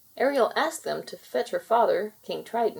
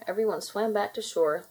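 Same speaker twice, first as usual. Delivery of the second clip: ordinary delivery, nothing marked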